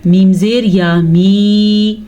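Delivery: slow drawn "मी" with long ee; spelling out slowly. Shown as a demonstration. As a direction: stretch "मी" for the ya madda. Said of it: "ये या मद्दा है". ("मी" लंबा खींचकर)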